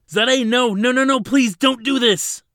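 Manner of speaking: very high, very loud pleading screams; desperate screaming. A narrator's reading. Ladin trapped in the field begging Zadeh, desperate screams. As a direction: scream and beg from behind the field.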